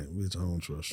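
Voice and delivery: low, quiet distrustful mama; muted mock suspicion; imitating mama. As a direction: drop it low and wary. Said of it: "my mama real like".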